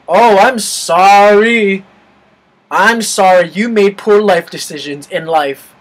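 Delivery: loud, drawn-out mock sorry; scathing, exaggerated sympathy; sarcastic apology. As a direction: throw a fake apology back at her. Sarcastic reply to mocked girl.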